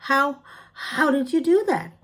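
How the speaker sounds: high, loud amazed stammer; astonished stammer. A narrator's reading. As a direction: gasp it out, amazed. Ellie stunned by magic.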